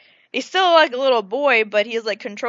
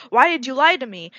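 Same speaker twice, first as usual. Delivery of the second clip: loud, raised accusing question; mock accusing; 4 semitones higher, 7 dB louder than usual. Boy confronting his lying mother, mock accusing. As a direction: throw it out as a hurt challenge.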